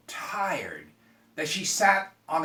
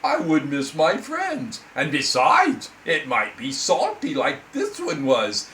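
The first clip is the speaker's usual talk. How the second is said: lamenting frog; 9 dB louder than usual. Acted. Frog complaining to the girl, loud and dramatic. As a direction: wail it like a theatrical frog. Frog's moral.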